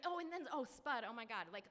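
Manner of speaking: ordinary delivery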